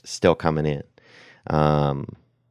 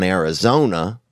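ordinary talk, nothing marked

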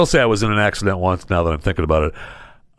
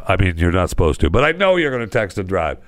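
ordinary delivery

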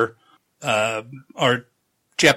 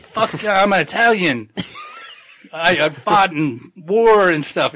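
laughing Italian; 6 semitones higher than usual. Retold or imitated. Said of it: "He's like".

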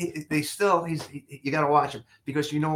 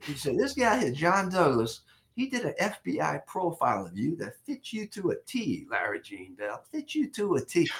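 imitating the prosecutor's Southern drawl, pitched higher; a folksy Southern solicitor showing off his visitors, high and drawling; mock Southern accent, lively and amused; 5 semitones higher than usual. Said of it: "that drawl, that Southern accent".

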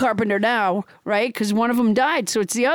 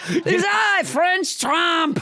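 grand mock-French self-announcement; French Trump; 4 semitones higher, slower than usual. French Trump announces himself to the host, grand French accent. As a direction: proclaim it in a hammed-up French accent. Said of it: "with a French accent".

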